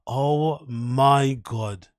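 drawn-out, slow disbelief; stunned reaction. Real, not acted. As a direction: stretch each word out, appalled. Reacting to a scathing review.

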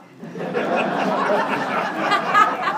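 big high-pitched burst of laughter; real loud laughter. Real, not acted. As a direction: crack up loudly at the memory. Laughing at own story.

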